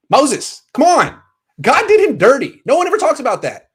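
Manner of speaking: high, outraged protest; indignant. Real, not acted. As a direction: pitch it up in mock outrage. Indignant rant about Moses.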